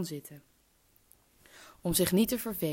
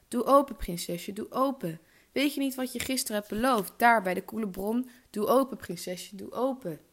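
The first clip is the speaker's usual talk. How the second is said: raised, higher insistent call; 6 semitones higher, 4 dB louder than usual. A narrator's reading. Frog reminding princess of her promise, calling out. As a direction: insist loudly from behind a shut door. Frog calls again at the door.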